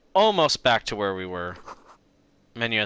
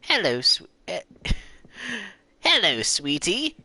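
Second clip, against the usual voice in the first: mock British accent; 10 semitones higher than usual. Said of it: "British accent".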